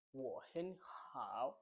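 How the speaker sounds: WO HEN HOW, HEN lifted and HOW dipping; third tone. Shown as a demonstration. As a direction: lift HEN, then swoop down and up on HOW. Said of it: "the third one".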